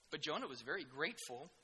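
ordinary delivery

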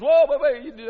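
loud, alarmed stop-right-there; mock alarm. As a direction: throw up the brakes in mock panic. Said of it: "and then everybody goes".

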